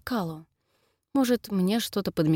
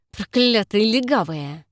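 slow, loud, spat through teeth; 2 semitones higher, 6 dB louder, slower than usual. Acted. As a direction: grind it out with contempt. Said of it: "процедил он". (процедил сквозь зубы)

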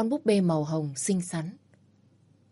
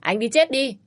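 higher, louder angry yell; 6 semitones higher, 4 dB louder than usual. Acted. Woman yells at the teasing man, angry. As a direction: shout it at him in a rage. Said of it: "Tôi hét lên".

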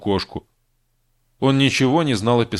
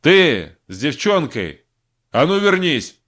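shouting; 4 semitones higher, slower than usual. Acted. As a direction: bark it after them. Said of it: "крикнул командир пограничников".

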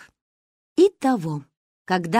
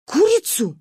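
alarmed; 10 semitones higher, 5 dB louder than usual. Acted. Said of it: "испугался папа".

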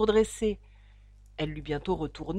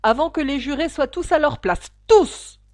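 louder, with "tous!" shouted high; emphatic; 5 semitones higher, 8 dB louder than usual. A narrator's reading. The king insists to the court, punching "tous!" loud and high. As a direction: hammer home the final "tous!". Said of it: "répéta-t-il avec emphase".

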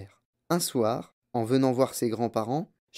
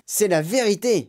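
put-on high, emphatic old woman's cry; raised-pitch grandmother's exclamation; 8 semitones higher, 4 dB louder than usual. A narrator's reading. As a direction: call it out in keen agreement. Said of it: "cria grand-mère Joséphine".

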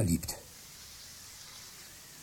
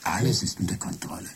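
much softer, lower and calm fake Michael; computer faking Michael; 7 semitones lower, 6 dB quieter than usual. Computer as Michael calming Jenny, soft and low. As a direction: soothe the wife in a borrowed voice. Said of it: "Ich kann deine Stimme imitieren".

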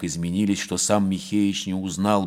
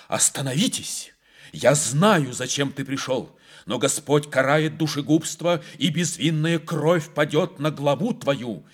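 higher, pleading, voice shaking; trembling voice; 8 semitones higher, 4 dB louder than usual. A narrator's reading. Abbess warning the intruding prince in a higher trembling voice. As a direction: warn him off with a trembling plea. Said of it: "сказала она дрожащим голосом".

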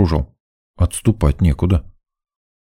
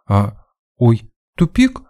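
put-on halting girlish voice rising very high; falter, then squeak a high question; bewildered; 5 semitones higher, slower than usual. A narrator's reading. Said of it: "растерялась девчонка". (растерянно, с высоким вопросом в конце)

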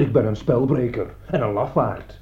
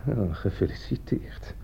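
breathy, slow whispered congratulations; mocking hushed praise; sarcastic whisper; 4 semitones lower, 7 dB quieter than usual. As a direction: hiss the congratulations under your breath.

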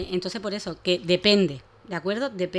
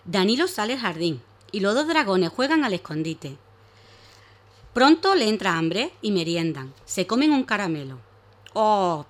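story narration; 4 dB louder than usual. Acted. Reads a story to children.

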